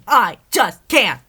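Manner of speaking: slow, very high, loud yell; angry yell. Acted. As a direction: drag out every word in fury. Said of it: "anger overtook her".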